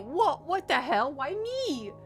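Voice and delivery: ordinary delivery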